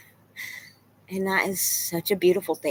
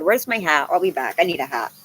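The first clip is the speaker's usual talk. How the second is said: ordinary talk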